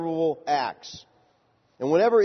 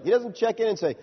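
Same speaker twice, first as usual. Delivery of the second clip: normal talking voice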